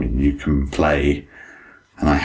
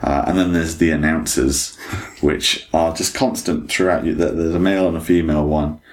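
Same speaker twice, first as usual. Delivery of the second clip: usual speaking voice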